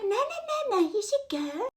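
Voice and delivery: high squeaky no, no; fake-sweet shooing him off; mock eager insistence. Girlfriend insists the boyfriend should go, high mock insistence.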